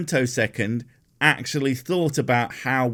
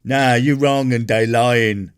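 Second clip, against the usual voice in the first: mock lazy argument; 6 dB louder than usual. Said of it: "the laziest".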